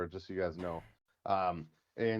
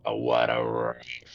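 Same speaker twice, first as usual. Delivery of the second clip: deep, slow wrestler growl; wrestling promo voice; 12 semitones lower, 4 dB louder, much slower than usual. Retold or imitated. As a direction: drag it out like a ring announcer promo. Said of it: "That's a wrestling, thing".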